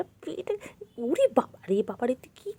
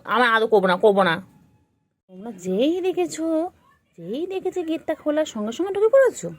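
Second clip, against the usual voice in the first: mock scolding; 4 semitones higher, 7 dB louder than usual. Real, not acted. Scolding pets.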